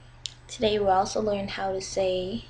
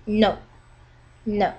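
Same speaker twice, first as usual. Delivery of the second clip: "no" dropping from high to low; falling tone; 6 dB louder than usual. Shown as a demonstration. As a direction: say "no" with a firm fall. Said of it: "It goes from high to low".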